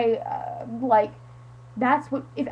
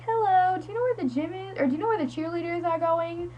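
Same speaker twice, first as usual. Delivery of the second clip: high-pitched, polite asking for directions; acting out a tentative, higher-voiced question; polite inquiry; 5 semitones higher, 4 dB quieter than usual. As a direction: ask sweetly up high, as if approaching a stranger.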